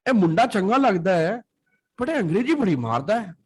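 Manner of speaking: a light imitation of a Punjabi relative teasing the English speaker. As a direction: mimic an amused Punjabi elder, quick and high. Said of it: "they'll feel like".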